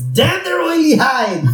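high, slow, drawn-out mock curse; Mister Burns. Boss cursing the lawyers he despises, hammed-up villainous outrage.

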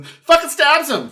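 high, loud, slow outrage; outraged. Retold or imitated. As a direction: shout it in disbelief. Outraged retelling of the stab.

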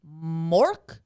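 loud, rising puzzled "Mork?"; baffled query. Retold or imitated. Pizza staff mishearing dad's name, baffled imitation. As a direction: repeat the name as a baffled question. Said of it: "And they go".

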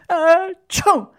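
loud, high "ecciù" sneeze; big acted sneeze. A narrator's reading. Mangiafuoco starting to sneeze, loud high mock sneeze. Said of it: "si mise a starnutire".

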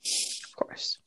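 breathy whispered aside. Real, not acted. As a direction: whisper it knowingly. Ironic aside.

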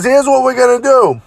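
wired veteran laying out the match, pitched high; Jimmy Snuka impression. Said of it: "He says".

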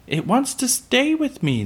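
put-on very high, pleading child voice; begging. A narrator's reading. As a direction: plead with Dad in a little girl's high voice. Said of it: "Laura begged".

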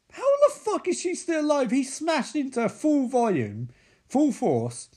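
high-pitched indignant disbelief; exasperated, disbelieving outburst; real incredulity. Real, not acted. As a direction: blurt it out in high-voiced disbelief. Viewer incredulous at the film.

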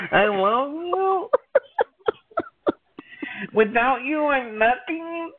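high, slow, hammed-up sob; fake-weep it like an overcome star; mock crying. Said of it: "mock tearful fan speech".

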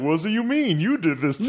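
high-pitched puppet accusation; hammed-up, high indignant puppet voice; accusing dummy. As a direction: play a wronged ventriloquist dummy.

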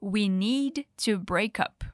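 slow, careful teacher model; slow model phrase. Shown as a demonstration. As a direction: say it slowly for learners to copy. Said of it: "A frase toda é". (frase lenta e clara)

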